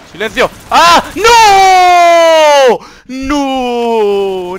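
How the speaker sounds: high, loud shriek of dismay; dismayed scream. Real, not acted. Gamer caught by enemy, loud shriek. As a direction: yell as you get caught.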